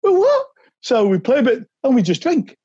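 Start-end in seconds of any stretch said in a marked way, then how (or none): 0.0-0.5 much higher, louder, a sharp incredulous question; stunned surprise. Retold or imitated. Player told to just drink at the clubhouse, stunned surprise. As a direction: blurt it out, caught completely off guard.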